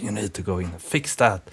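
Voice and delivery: hushed whisper in the ear; demon's whisper. Acted. Nagging demon to a bothered programmer, whispering in the ear. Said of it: "whispers in my ears".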